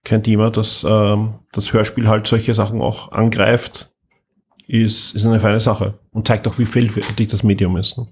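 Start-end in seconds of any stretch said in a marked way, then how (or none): none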